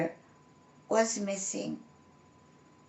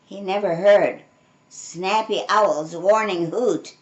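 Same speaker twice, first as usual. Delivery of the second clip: plain reading voice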